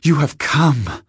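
high, loud, slow cry of joy; a rapturous, drawn-out cry; glad cry. A narrator's reading. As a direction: greet her master in ecstasy. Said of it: "she cried".

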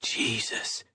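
hushed, voiceless mutter; barely audible, appalled whisper. A narrator's reading. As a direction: mutter it under your breath. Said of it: "Garrity muttered".

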